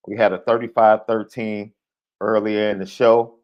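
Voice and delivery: ordinary delivery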